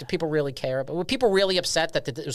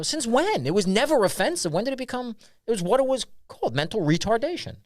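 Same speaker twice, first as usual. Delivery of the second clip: mock indignant retort; 7 semitones higher than usual. Retold argument with critics.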